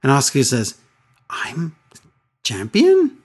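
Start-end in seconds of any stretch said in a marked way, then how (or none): 1.3-3.2 imitated puzzlement. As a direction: imitate someone puzzled, halting before the key word. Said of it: "Asuka's puzzled by this".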